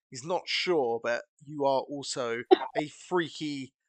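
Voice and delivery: ordinary talk, nothing marked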